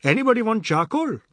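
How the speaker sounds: loud, high vendor call. A narrator's reading. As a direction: hawk it like a street seller. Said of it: "Mister Biswas called to them".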